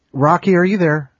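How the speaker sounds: loud, high call over Skype. Real, not acted. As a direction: call out to him loudly. Calling out on Skype.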